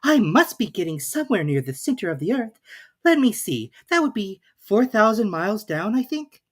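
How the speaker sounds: put-on young Alice working it out aloud, voice going up. A narrator's reading. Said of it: "she said aloud".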